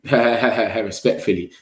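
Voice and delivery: usual speaking voice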